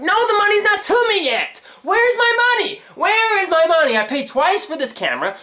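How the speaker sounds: very high, indignant demand; angry outburst. Acted. Customer demands money back from store, high angry outburst. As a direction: demand it in a high, exasperated cry. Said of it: "where I was angry about".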